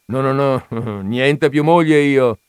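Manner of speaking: slow, loud, laughing denial; mock-serious denial broken by a laugh. A narrator's reading. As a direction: laugh off the very idea of a wife. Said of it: "con serietà".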